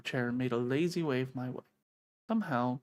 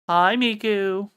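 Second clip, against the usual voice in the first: drawn-out loud greeting; 5 semitones higher, 11 dB louder, much slower than usual. Real, not acted. Greeting the Cheshire Cat.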